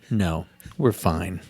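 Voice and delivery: a woman's passive-aggressive we're fine; loaded dismissive fine. Retold or imitated. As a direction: say it like nothing is fine. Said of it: "when you hear that from a woman".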